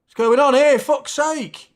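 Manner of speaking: loud, high burst of outrage at the screen; outraged shout. Real, not acted. Yelling at the match on screen, outraged shout. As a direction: yell it at the telly in disbelief.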